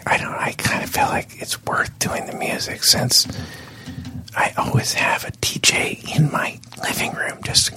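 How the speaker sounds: mock-serious whisper. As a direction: whisper as if it's a secret plan. Hosts drop into whispers.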